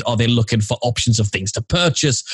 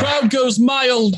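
mock announcer; 11 semitones higher, much slower than usual. Mock crowd cheer after song.

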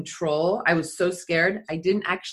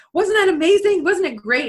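much higher and louder, gushing over the jump; gushing video guy; 12 semitones higher, 7 dB louder than usual. Retold or imitated. Video guy cheering her on after landing, much higher and louder. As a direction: pump her up like a thrilled cameraman.

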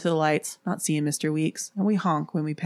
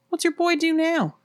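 hitchhiker voice; 12 semitones higher than usual. Acted. Hitchhiker asks sudden question.

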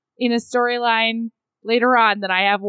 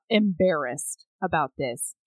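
ordinary talk

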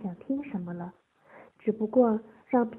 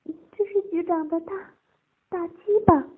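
high, hesitant, then louder; falter, then force it out; trembling voice; 9 semitones higher, 6 dB louder than usual. A narrator's reading. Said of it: "颤声说道".

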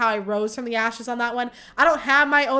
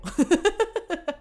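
high laugh after the line; 6 semitones higher than usual. Real, not acted. Podcaster laughing at her own warning, high laugh. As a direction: laugh it off, pitched up.

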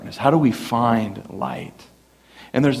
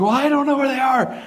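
flustered dad; 11 semitones higher than usual. Retold or imitated. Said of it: "he's like".

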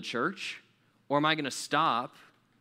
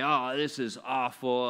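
slow, put-on complaining groan; mock grumbling; slower than usual. Preacher voicing his temptation to complain about a crying baby, mock grumbling. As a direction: grumble it out slowly, half in jest. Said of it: "grumbling, complaining about this baby".

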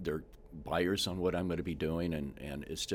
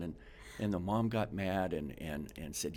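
ordinary talk, nothing marked

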